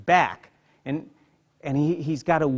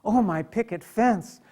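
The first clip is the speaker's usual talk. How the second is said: Emily in wonder; 6 semitones higher, 7 dB louder than usual. Acted. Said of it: "she begins, like, looking at".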